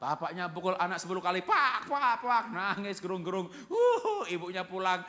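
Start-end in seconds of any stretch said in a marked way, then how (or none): 1.5-2.4 loud, high "pak" for each blow; smacking sounds. Acted. Father hitting child ten times, loud mimed smacks. Imitates father's repeated blows. 3.7-4.3 very high, loud "wuuh"; hammed-up bawling whoop; mock wail. Beaten child crying hard, high mock wail.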